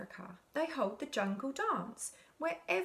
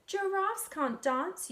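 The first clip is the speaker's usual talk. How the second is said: higher, sing-song jeering; mocking taunt; 8 semitones higher, 3 dB louder than usual. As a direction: taunt him with it. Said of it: "the animals all laughed".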